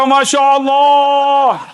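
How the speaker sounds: slow, drawn-out high exclamation; amazed. Retold or imitated. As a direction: marvel at it, stretching the words. Policeman's amazed reaction.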